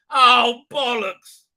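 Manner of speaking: loud, high mock exasperation; exasperated. Owner giving in to the cat, loudly exasperated. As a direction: cave in with a noisy curse.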